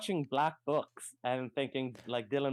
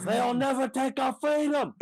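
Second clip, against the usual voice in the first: high, loud mock battle cry; hammed-up freedom speech, bellowed high; rallying cry; 12 semitones higher, 5 dB louder than usual. Quoting a battle cry to the table, loud and high.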